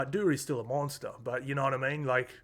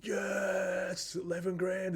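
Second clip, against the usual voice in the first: retold excited cheer; 6 semitones higher, much slower than usual. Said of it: "I was sitting there going".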